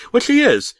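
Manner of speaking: gloating jab. Acted. John Redcorn taunting Dale.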